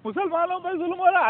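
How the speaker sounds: high, wailing mock plea; high mocking wail of victimhood; mocking whiny complaint. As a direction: parody a self-pitying crowd crying injustice. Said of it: "گدھے جیسی آواز نکال کے چلے آتے ہیں".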